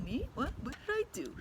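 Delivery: high, soft fake-innocent squeak; pretend innocence. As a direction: widen your eyes and play dumb. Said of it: "like he's pretending".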